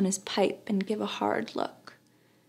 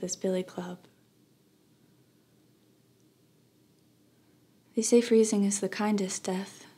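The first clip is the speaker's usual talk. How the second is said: soft, grieving narration fading out; tragic heroine sorrow; 6 dB quieter than usual. A narrator's reading. Somber memoir narration of death.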